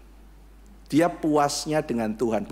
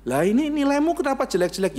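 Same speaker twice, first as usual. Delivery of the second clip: scolding teacher; 10 semitones higher than usual. Retold or imitated.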